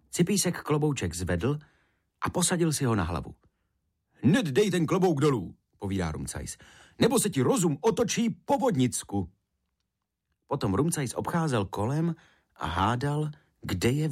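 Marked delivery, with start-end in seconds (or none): 4.2-5.5 urgent warning. A narrator's reading. Rumcajs ordering Cipísek to take the hat off, urgent warning. 7.0-9.3 high, animated warning; urgent warning. A narrator's reading. Rumcajs warning Cipísek about the water sprite's hat, urgent warning.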